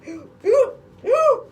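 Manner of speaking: very high, loud hooting gasps; hammed-up imitation of panicked gasps; mock gasping hoots. Mimicking the grieving girl, very high mock gasps. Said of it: "she starts saying".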